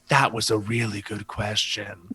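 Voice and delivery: low, slow, measured praise; low confiding verdict. Retold or imitated. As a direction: say it slowly down in a low voice. Acts out friend's hushed verdict.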